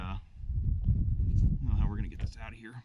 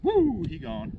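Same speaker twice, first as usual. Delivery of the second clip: cheering whoop; 11 semitones higher, 9 dB louder, slower than usual. Real, not acted. Fish released, cheering.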